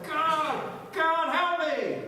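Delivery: high, loud, drawn-out plea; panicked cry for help. Acted. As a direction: cry out to heaven in terror. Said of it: "in horror and panic, he cries out".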